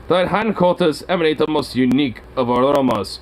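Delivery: high, grandiose pet impression; cat's imagined voice. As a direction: voice the cat savouring a smell, mock-refined. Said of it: "he's all like".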